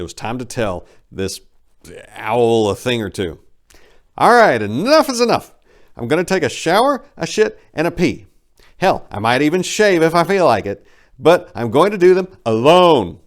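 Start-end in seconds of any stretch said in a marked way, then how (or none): 4.2-13.2 loud, high furious shout. Acted. Narrator shouting at the big bird, furious. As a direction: yell at the bird to get out. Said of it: "I shouted".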